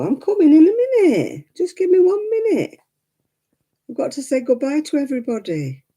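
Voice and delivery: high, sing-song voice for the dog; high pet talk. Real, not acted. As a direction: coo to the dog that she is coming. Soothing her impatient dog.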